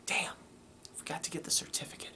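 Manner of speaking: under the breath, whispered; whispered aside. Real, not acted. Grumbling to himself about the forgotten certificate, whispered. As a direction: whisper it to yourself, annoyed. Muttered aside after forgetting.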